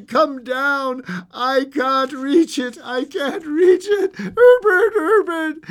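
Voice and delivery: high, loud, drawn-out wailing; strained, panting cry. A narrator's reading. Old woman calling from downstairs, strained panting cry. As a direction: wail desperately, straining to reach. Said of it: "strained and panting".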